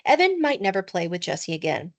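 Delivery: usual speaking voice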